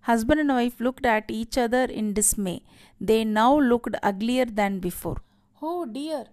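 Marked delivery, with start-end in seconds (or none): 5.6-6.2 soft, raised-pitch lament; understated, near-tearful storyteller's voice; almost sobbing. A narrator's reading. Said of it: "almost sobbing".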